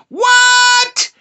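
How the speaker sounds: very high, loud yell; hyped shout. Acted. Comic reacts to her profile, high hyped shout. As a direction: blast it out high and long.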